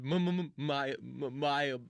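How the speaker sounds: mock stammer on repeated syllables; imitating a stuttering, broken apology; stuck in a loop. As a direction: stumble over each word as if stuck. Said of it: "He just got trapped in a loop".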